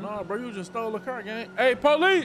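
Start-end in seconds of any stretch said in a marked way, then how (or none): 1.6-2.2 loud, high call for the police; sudden loud cry for help; shouting for police. Acted. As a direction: yell it out across the lot. Yelling for police at the car thief.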